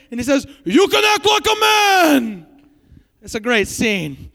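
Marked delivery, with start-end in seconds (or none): 0.6-2.5 high, drawn-out mock shout; Godfather. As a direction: bellow it slowly like a scolding mob boss. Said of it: "pulls him up and slaps his face".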